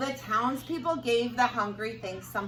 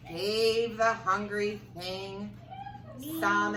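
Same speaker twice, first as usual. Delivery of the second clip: usual speaking voice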